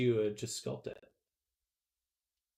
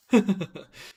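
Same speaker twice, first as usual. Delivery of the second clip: laugh; 8 semitones higher, 9 dB louder than usual. Real, not acted. Laughing at robo butt joke.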